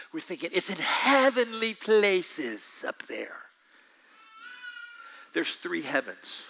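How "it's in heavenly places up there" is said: slow, dreamy, far-off tone; mock vague notion. Preacher mocking people who think the fight is up there, slow. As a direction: float it off lazily.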